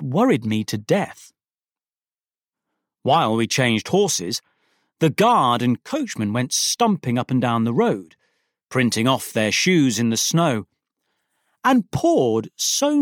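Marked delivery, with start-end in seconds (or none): none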